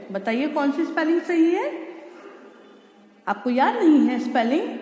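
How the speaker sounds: usual speaking voice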